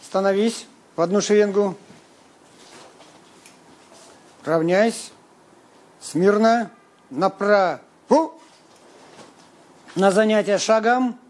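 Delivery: barked military drill commands; shouting drill sergeant. Acted. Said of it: "командуйте".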